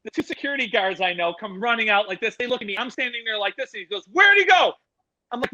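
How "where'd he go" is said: high, loud retold shout; raised voice, pressing question; urgent demand. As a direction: imitate a guard in mid-chase. Guard running out, demanding directions.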